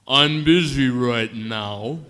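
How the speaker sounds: very slow, loud, drawn-out words; drugged patient hearing the nurse in slow motion; slow-motion speech. Retold or imitated. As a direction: drag every syllable like a slowed replay. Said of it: "they were all talking like this".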